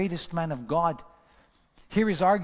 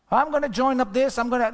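high, loud mock-eager promises; mocking promiser; 8 semitones higher, 6 dB louder, faster than usual. Preacher mocking people who promise but never do, high mock eagerness. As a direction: lampoon the big talker.

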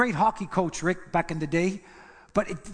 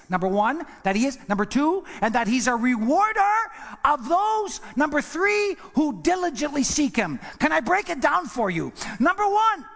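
higher and louder, building preacher cadence; preaching at full force; 8 semitones higher, 4 dB louder than usual. Acted. Preacher breaking down the verse for the church, at full force. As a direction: build each number to a shout.

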